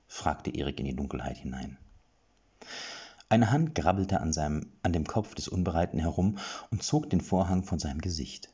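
usual speaking voice

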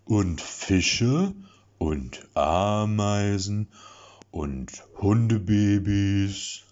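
put-on slow, drawn-out babyish list; cheerful singsong. A narrator's reading. Lasse listing things he likes, slow babyish singsong. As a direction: croon each item happily. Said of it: "in beinahe fröhlichem Singsang".

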